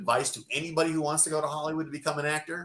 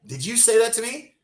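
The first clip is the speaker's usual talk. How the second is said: confronting; 10 semitones higher, 4 dB louder than usual. Retold or imitated. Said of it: "she goes".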